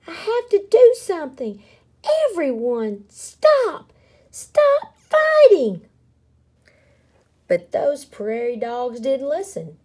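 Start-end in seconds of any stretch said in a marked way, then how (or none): 0.1-5.9 high, loud plea building to a shout; urgent shouting. A narrator's reading. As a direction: shout over a fight to stop it. Pipsqueak tries to stop battle.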